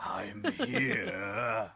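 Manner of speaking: lightly voiced theatrical phantom, lowering the cape; a hint of a dramatic ghostly announcement; Phantom of the Opera. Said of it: "I'm like a Phantom of the Opera".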